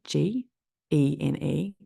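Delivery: normal talking voice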